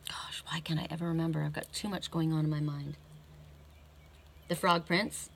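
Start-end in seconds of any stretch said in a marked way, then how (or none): 0.1-3.0 hushed, soft self-reproach; muttering to self. Real, not acted. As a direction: think aloud under your breath. Muttering to self, trying to remember.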